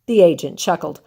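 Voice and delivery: ordinary delivery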